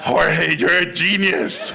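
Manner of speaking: loud, slow, hammed-up praise; retold executive's booming acclaim; mock proclamation. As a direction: proclaim it like a grand verdict. President proclaims him a genius.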